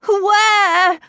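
high, loud desperate question; desperate cry. A narrator's reading. Miles looking helplessly round the room, desperate cry.

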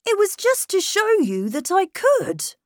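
put-on a boy insisting he's grown. A narrator's reading. Miles telling his governess he's growing up.

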